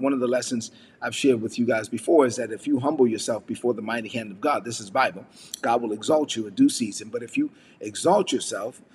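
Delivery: ordinary talk, nothing marked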